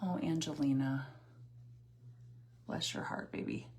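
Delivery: normal talking voice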